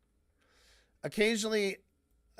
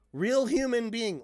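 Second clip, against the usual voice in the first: louder, higher, baffled restatement; incredulous repeat; 6 semitones higher, 6 dB louder than usual. Retold or imitated. Scorning a commenter's phrase before viewers, baffled and louder. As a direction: throw the phrase back in disbelief. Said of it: "what the fuck are you trying to say?".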